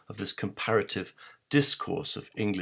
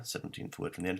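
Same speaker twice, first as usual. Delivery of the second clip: soft breathy whisper, much softer; whispered repeat; 9 dB quieter, faster than usual. Shown as a demonstration. Lecturer to viewers, repeating the clue in a whisper. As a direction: let the clue slip out in a hush.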